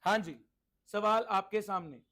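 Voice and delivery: ordinary talk, nothing marked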